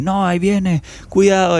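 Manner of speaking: high-pitched, hammed-up cry of warning; dramatized alarm. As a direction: play-act panic at an approaching dog. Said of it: "Incluso grabábamos dramatizaciones". (alarma fingida, grito agudo de advertencia)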